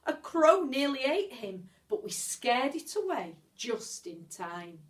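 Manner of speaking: ordinary delivery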